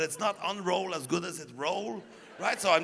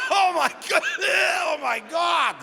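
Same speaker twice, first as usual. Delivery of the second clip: high, loud, revolted cry; hammed-up horror, nearly gagging; horrified disgust; 11 semitones higher, 6 dB louder than usual. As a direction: cry out as the stench hits. Acts horror at the smell.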